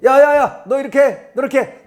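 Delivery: loud, high "야, 야, 야" then orders; bossy yelling at a kid. Retold or imitated. Adult scolding someone else's child, loud and high. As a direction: call out sharply like scolding a child.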